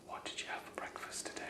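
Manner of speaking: very soft, fully whispered question. Shown as a demonstration. Clinician testing a patient's hearing, whispering. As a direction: whisper it with no voice at all. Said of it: "Even though I'm whispering".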